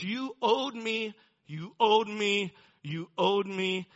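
a retold grievance building to a slow loud cry; hurt accusation. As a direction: pound out you owed me as a wounded son. Climax of the accusation.

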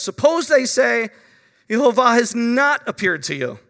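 loud fretful what-if; anxious objection. Acted. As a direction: press the doubt urgently. Preacher voicing Moses' anxious objection.

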